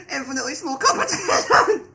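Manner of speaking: loud, high read breaking into laughter; capitalised emphasis. Acted. Reading out the AI's capitals, loud mock emphasis dissolving into laughter. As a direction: push it up high and loud, cracking up. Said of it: "it goes and capitalizes".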